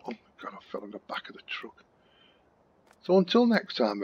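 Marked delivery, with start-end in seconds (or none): 0.0-1.8 whisper. Real, not acted. Sudden aside after a mishap.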